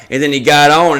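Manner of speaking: ordinary talk, nothing marked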